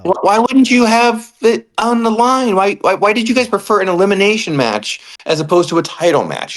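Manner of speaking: high, incredulous complaint; exasperated rant. Real, not acted. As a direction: vent at the bookers in disbelief. Ranting at bookers.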